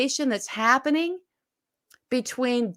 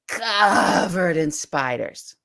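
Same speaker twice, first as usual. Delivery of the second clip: slow reveal; 5 semitones lower, much slower than usual. Acted. Dramatic reveal in story.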